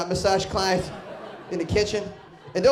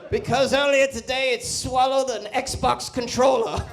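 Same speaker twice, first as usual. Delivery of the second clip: documentary narrator; 7 semitones higher than usual. Retold or imitated. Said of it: "old English dude's got a very sweet voice".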